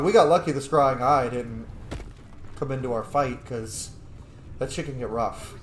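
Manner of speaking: normal talking voice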